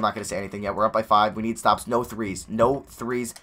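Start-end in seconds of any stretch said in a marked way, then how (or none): none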